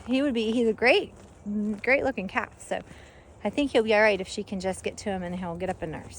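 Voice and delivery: ordinary talk, nothing marked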